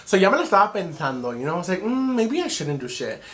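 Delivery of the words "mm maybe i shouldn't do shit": higher, drawn-out second-guessing; hesitant. Retold or imitated. Woman recalling her second thoughts about the hookup, hesitant. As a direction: mull it over slowly in a higher voice.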